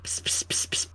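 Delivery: sneaky hissed psst calls; whispering. Pretend. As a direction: whisper to lure him out. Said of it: "luring Tome out of his room".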